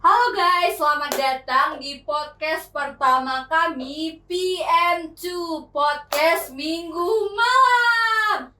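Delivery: upbeat host opening, drawn out into a cheer; excited show-opener voice; hyped podcast intro. Real, not acted. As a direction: kick off the show, stretching the title into a shout.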